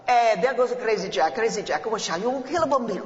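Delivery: loud, jeering Italian-American neighbors' calls; Sicilian neighbors. Retold or imitated. Sicilian neighbors jeer at the father, loud Italian-American accent. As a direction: heckle like an old Sicilian neighbor across the street. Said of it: "They opened up".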